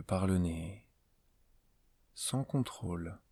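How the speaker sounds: slow, spaced-out meditation voice with long pauses; guided meditation. Acted. Teacher leading a listener into yoga nidra before sleep, calm guided meditation. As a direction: guide the listener gently through a body scan, unhurried.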